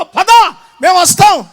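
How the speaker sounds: loud, high rallying call; rallying shout. Acted. Elders backing Jephthah, rallying shout. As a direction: shout it like a promise.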